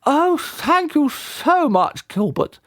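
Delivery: high, slow gushing thanks; delighted vicar. A narrator's reading. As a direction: gush it like an overjoyed bride. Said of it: "exclaimed Reverend Judith".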